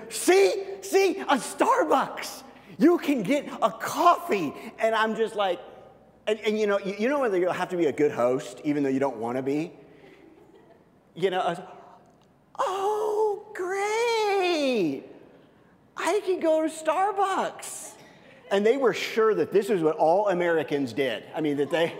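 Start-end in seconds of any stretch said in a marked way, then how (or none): 0.1-4.5 eager showing off. Retold or imitated. Irish friends show their guest a Starbucks, delighted and high. 12.6-17.9 high, slow fake delight; mock enthusiasm. Guest pastor feigns delight at being taken to Starbucks. Forced politeness of a good host.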